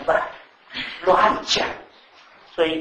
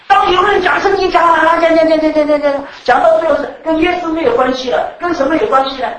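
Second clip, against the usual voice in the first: loud, high, hammering repetition; preaching at full force; 8 semitones higher, 9 dB louder than usual. Acted. Preacher warning the congregation about empty Bible talk, full-force preaching. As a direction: pound each word like a pulpit drumbeat.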